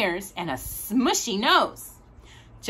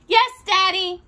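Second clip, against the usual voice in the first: put-on much higher, sweet little girl voice; little girl Daisy; 10 semitones higher, 4 dB louder than usual. A narrator's reading. Daisy answering her daddy, high and sweet. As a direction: answer brightly like a small child.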